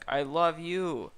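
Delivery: loud, high, drawn-out declaration; shouted declaration. A narrator's reading. Shrek declaring love to Fiona, hammed-up shout. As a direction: shout it slowly and theatrically. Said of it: "shouts Shrek".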